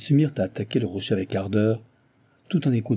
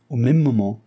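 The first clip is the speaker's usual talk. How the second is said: plain reading voice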